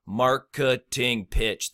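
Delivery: slow, split into four separate syllables; drawn out with a gap after each syllable. Shown as a demonstration. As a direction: break MARKETING PITCH into mar-ke-ting-pitch, evenly spaced. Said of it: "that's four syllables".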